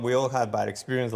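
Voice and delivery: ordinary delivery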